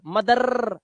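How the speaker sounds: मदर with a long trilled final r; wrong rolled r. Shown as a demonstration. Teacher showing the mistake, मदर with rolled r. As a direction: drag out the r at the end of मदर. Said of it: "रा नहीं करना है".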